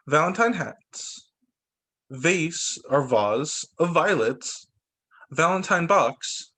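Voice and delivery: each word read out slowly; name each picture slowly for a child. Shown as a demonstration. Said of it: "Can you read these words".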